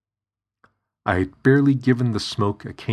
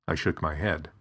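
ordinary delivery

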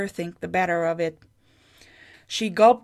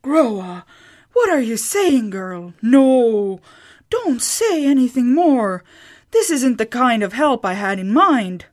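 shocked protest, louder; scandalized refusal, louder; 4 semitones higher, 6 dB louder than usual. A narrator's reading. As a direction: cut her off in alarm. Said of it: "Sigrid gasped".

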